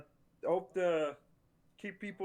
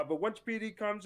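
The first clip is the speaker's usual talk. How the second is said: normal talking voice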